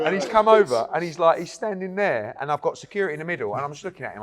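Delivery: normal talking voice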